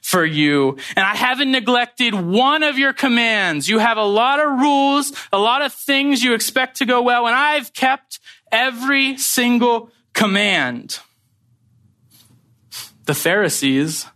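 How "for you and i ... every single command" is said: put-on aggrieved older son. Older son complaining of slaving for his father, aggrieved. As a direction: hammer out each grievance, stretching the stressed words. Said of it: "I've been slaving for you".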